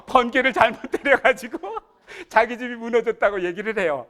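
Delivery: 황당한 사연을 웃음 참으며 전하기; laughing through the absurd claim. Real, not acted. 황당한 고소 내용을 전하며 웃음. (웃음을 터뜨리며)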